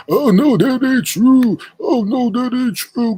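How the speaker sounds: high, loud, drawn-out mimicry; mock her with a yappy high denial; exaggerated high-pitched mocking voice; barking chihuahua impression. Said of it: "So you gonna sit up there and say".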